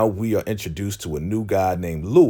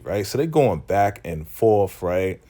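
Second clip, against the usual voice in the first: normal talking voice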